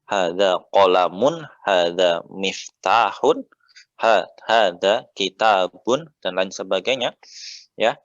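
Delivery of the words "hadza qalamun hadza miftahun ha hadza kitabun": slow, louder "hadza qalamun", "hadza miftahun", "hadza kitabun" with the -un endings drawn out; stressing final vowels. Shown as a demonstration. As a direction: lean on the dhammah ending -un of each word, slowly. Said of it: "perhatikan harokatnya".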